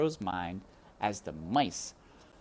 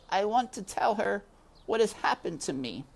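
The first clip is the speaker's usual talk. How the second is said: high, small mouse voice asking politely; a hopeful little mouse making a request; voiced high, as a small mouse; timid mouse Despero; 12 semitones higher than usual. A narrator's reading. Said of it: "Despero asked".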